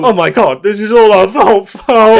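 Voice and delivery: loud, high, overdone guilty gasp; mock panic. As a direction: play the horrified friends, over the top. Acting out an imagined apology.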